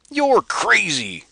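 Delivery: high, loud, slow blast of disbelief; exploding outburst. Acted. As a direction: burst out, dragging each word. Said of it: "exploded Mado".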